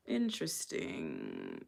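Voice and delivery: low, slow, drawn-out "Interesting."; a deep, stretched-out "Interesting."; knowing interesting. Real, not acted. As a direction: let "Interesting." sink low and slow, full of meaning. Reacting to news he's married.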